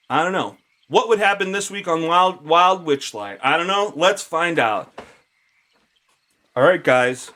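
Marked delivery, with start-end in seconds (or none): 0.1-4.9 high, loud show host tease; announcer voice. Acted. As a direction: hype it like a TV announcer.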